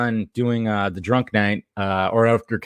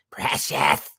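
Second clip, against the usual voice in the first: whispered, lower Gollum hiss; 9 semitones lower, 4 dB quieter than usual. Retold or imitated. Streamer answering a chat joke about Gollum, whispered mock Gollum. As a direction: breathe it out like Gollum. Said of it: "you need some Gollum juice".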